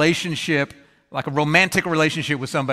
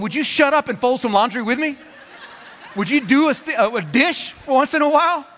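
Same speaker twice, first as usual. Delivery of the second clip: higher, exasperated wife, half laughing; 6 semitones higher than usual. Retold or imitated. Tired wife to praising husband, higher and exasperated. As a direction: snap at him to help, mock-annoyed. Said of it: "she's going".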